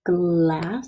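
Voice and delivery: "glass" with a lengthened L; stretched L blend. Shown as a demonstration. Teacher writing glass and showing her L blend, stretched. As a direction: draw out the L in "glass". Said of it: "there's my L blend".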